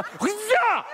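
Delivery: high laughing "Huzzah!"; triumphant laughing cheer; mock cheer. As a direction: whoop it through a laugh. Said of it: "That's a".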